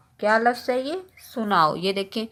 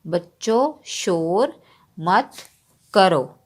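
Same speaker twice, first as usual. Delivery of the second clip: slow dictation; slower than usual. Shown as a demonstration. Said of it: "dictating sentence while writing".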